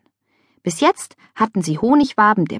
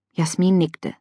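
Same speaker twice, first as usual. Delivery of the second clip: plain reading voice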